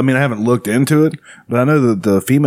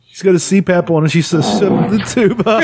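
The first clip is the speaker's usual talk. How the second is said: high, giggly play-by-play; laughing commentary; 6 semitones higher than usual. Real, not acted. Host explains the video to co-host, laughing. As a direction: keep talking through real laughter.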